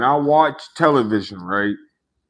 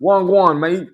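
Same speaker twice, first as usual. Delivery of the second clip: hammed-up London greeting; 6 semitones higher, slower than usual. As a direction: belt out the London greeting, playing it up. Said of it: "I shoulda said".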